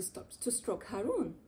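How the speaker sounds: ordinary delivery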